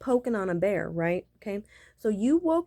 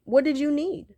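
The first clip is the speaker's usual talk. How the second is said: raised impatient question; 6 semitones higher, 4 dB louder than usual. Retold or imitated. Repeated louder, higher.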